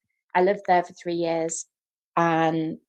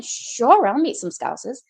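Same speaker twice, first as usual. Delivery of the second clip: eager reply; 5 semitones higher, 6 dB louder than usual. Retold or imitated. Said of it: "And I'm like".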